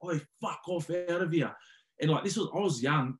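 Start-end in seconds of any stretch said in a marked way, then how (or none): 0.0-1.5 retold yell. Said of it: "you just see Matt Giddo going".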